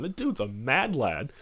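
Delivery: ordinary delivery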